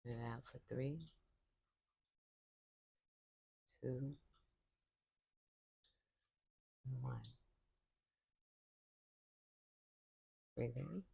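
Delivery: calm, unhurried voice with long silences between cues; guided meditation. Real, not acted. Yoga teacher leading listeners into breathing, calm guided meditation. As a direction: count the breaths softly, leaving room to breathe.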